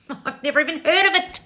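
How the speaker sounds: high and laughing through the words. Real, not acted. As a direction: laugh it out in disbelief. Laughs while saying it.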